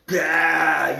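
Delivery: loud, high "bah" cry; triggered outburst. Retold or imitated. Imitating the friend suddenly going off, triggered outburst. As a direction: imitate a sudden agitated squawk. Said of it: "it just really agitated. It triggered".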